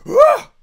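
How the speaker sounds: very high loud recoiling cry; mock alarmed scream; panicked shriek. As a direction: shriek as if pushed away. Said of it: "it makes them feel like".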